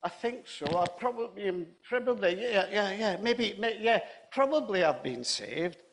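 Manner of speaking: acted bewildered old gentleman; confused elderly gent. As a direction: fumble for the answer, unsure and confused. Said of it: "elderly gent was still confused".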